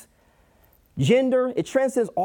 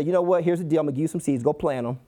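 low, casual, sinking delivery; offhand dismissal; 4 semitones lower, much faster than usual. Acted. God hypothetically handing over seeds, offhand and dismissive. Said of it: "He could say".

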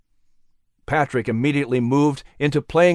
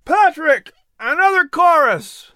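much higher, louder call across the tavern; 13 semitones higher, 4 dB louder than usual. Acted. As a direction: gentleman calls the fiddler for a song. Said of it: "calling to fiddler".

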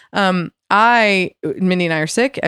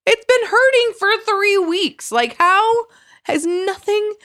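exasperated complaint; 11 semitones higher, slower than usual. Retold or imitated.

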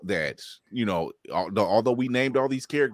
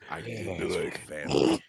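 quiet, lower, rough gravelly tough-guy line; 10 semitones lower, 6 dB quieter than usual. Shown as a demonstration. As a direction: growl it like a gravelly action hero. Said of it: "Give him the rough, gravelly voice".

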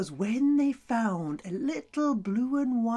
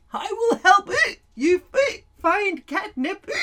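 hiccuping cow; 9 semitones higher, 5 dB louder than usual. Acted. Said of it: "she also had hiccups".

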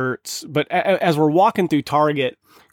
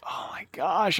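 breathy whispered exasperation under his breath; exasperated whisper; 3 semitones higher, 9 dB quieter, slower than usual. Retold or imitated. Father whispering his irritation as daughter refuses jujitsu, exasperated whisper. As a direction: hiss it to yourself, fed up.

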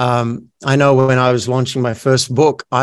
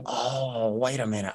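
quiet inner thought; 12 dB quieter than usual. Retold or imitated. Quoting own sudden realization.

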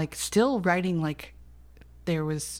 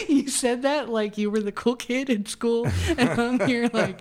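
higher, laughing through the teasing; 6 semitones higher, 3 dB louder than usual. Real, not acted. Guest laughing at the host's nerd remark. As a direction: giggle while protesting.

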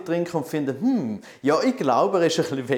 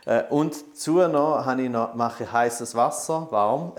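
ordinary talk, nothing marked

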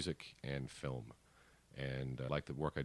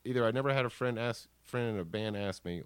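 much higher and louder, fading down; thrilled; 11 semitones higher, 14 dB louder than usual. Real, not acted. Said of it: "I was thrilled".

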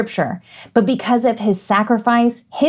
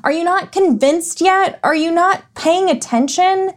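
high-pitched exasperated sarcasm; exasperated, incredulous questioning; mock frustration; 9 semitones higher than usual. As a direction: plead in disbelief, voice pitched way up. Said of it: "how frustrating that must have been".

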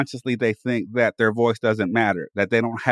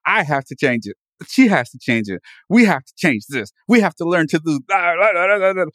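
mock self-scolding; 5 semitones higher, 4 dB louder than usual.

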